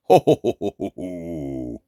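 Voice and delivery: low rolling ho-ho-ho, sinking away; mock deep "ho ho ho". Teacher hamming up the heavenly voice, deep trailing ho-ho-ho. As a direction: let a mock-divine chuckle drop very low.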